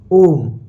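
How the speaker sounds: ओम with a long, loud ओ; drawn-out Om. Shown as a demonstration. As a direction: stretch the ओ in ओम over three beats. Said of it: "ऐसे लंबा खींचते हैं". (ओम का ओ लंबा खींचकर)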